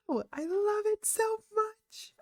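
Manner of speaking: very high, slow squeal of adoration; gushing squeal. Real, not acted. Fan watching her concert video right now, gushing squeal. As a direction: squeal it, drawing the words out.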